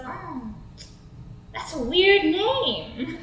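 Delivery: very slow, stretched-out creep; swaggering guy. Acted. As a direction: draw it out like a stoned flirt. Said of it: "And he goes".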